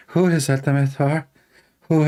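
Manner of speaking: lightly camp mock puzzlement; a hint of a put-on effeminate voice; imitating effeminate caller. Mimicking the caller's reply yesterday, lightly camp. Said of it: "using a voice of a, of, of a homosexual".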